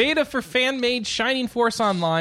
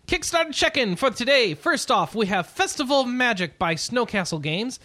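higher-pitched, energetic show-host read; upbeat segment announcer; 6 semitones higher than usual. Shown as a demonstration. Podcast host opening a game news segment, higher-pitched announcer read. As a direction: launch brightly into the segment rundown. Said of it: "trying to do it like a Japanese segment".

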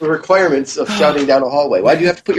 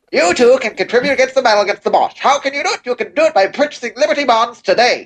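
put-on higher, fast old-time newsreel voice; 1920s radio announcer; 5 semitones higher, faster than usual. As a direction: sell war bonds like a 1920s broadcaster. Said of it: "we are in B B C One in nineteen twenties Britain".

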